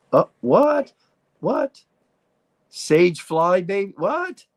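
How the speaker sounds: high, rising squeal of "what"; astonished. Real, not acted. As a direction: react in baffled surprise, pitch leaping up. Startled reading a viewer's comment.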